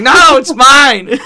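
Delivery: high, loud, drawn-out mock tantrum; squabbling four-year-old. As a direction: whine it slowly and loudly like a small kid. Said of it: "resorting to four-year-olds".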